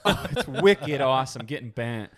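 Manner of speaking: laughing mock Boston accent; a light Boston twang through a laugh. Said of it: "Is she from Boston?".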